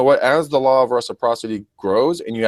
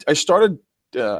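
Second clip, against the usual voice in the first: normal talking voice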